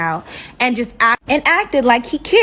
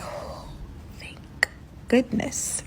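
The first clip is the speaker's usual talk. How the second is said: breathy, unvoiced sigh of relief; relieved whisper; 2 semitones higher, 13 dB quieter, slower than usual. Silent relief at car running out.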